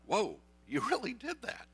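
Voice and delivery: as Sarah amazed at the newborn; amazed whoa. Retold or imitated. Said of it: "She's like".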